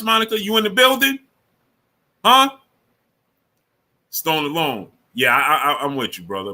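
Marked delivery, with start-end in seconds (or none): 0.0-2.6 excited call-out. Real, not acted. Calling out to a viewer.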